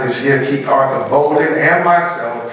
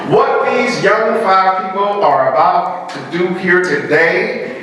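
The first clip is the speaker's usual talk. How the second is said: higher-pitched, emphatic oratory; rally the audience, building to a count-in; rousing formal speech; 5 semitones higher than usual. Real, not acted. Speaker urging audience to encourage five young performers, rousing speech.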